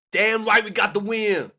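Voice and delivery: loud, slow triumphant cry of damn right; triumphant shout. Real, not acted. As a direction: belt it out like a fan after a late winner. Celebrating win with chat.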